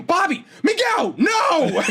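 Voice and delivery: high, slow shout of the teacher; teacher breaking up a fight, shouting high. Retold or imitated. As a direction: yell their names to break up the fight. Teacher yelling to stop fight.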